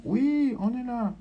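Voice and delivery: high, loud answering cry; shouting. Acted. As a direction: shout back so she can hear. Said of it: "Suzanne criant".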